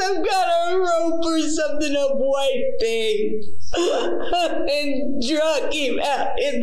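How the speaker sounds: high, slow breaking voice through sobs; crying. Real, not acted. Confessing the killing to detectives, sobbing. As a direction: sob it out, breath catching. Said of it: "Get your breath now".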